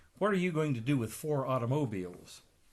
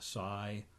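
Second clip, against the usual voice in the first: plain reading voice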